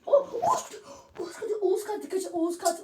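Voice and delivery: high, loud alarmed cry; panicked shouting. Real, not acted. Panicked warning during game. (panikle bağırarak)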